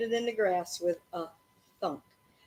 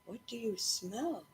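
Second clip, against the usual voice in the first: soft question; 10 dB quieter than usual. Acted. Ben asking his dog.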